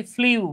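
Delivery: "ফ্লিউ" said with a y-glide, flyoo; "ফ্লিউ" with an ee sliding into oo; common mispronunciation. Shown as a demonstration. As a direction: say "ফ্লিউ" as flee-oo, the mistaken way. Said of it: "আমরা অনেকে এটা উচ্চারণ করি".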